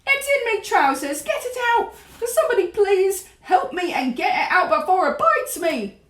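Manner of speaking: high-pitched, desperate cook's cry; shouting. A narrator's reading. Cook begs somebody to get the mouse out, shouting. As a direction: plead at the top of the voice. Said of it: "the stupid cook still shouting".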